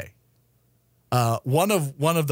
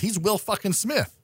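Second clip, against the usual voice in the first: usual speaking voice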